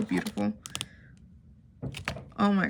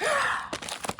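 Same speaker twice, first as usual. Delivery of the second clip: gasp; 5 dB quieter than usual. Real, not acted. Unboxing a long-wanted item.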